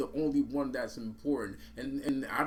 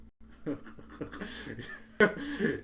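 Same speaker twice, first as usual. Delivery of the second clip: short rueful laugh; real laugh; 5 semitones higher than usual. Real, not acted. Laughing at the betrayers.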